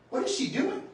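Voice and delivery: high, bewildered inner question; puzzled surprise. Retold or imitated. Inner thought watching mother ascend.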